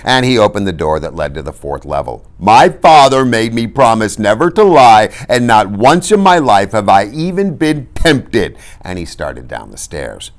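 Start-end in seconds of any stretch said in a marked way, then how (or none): 2.4-8.6 loud, higher wounded protest; loud righteous complaint; indignant Fezzik. A narrator's reading. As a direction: declare it with hurt pride. Fezzik indignant about lying.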